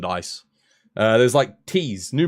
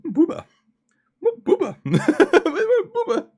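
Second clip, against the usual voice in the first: rising squeak, getting higher each time; squeaky high Booba; much slower than usual. Pretend. Escalating silly repeats.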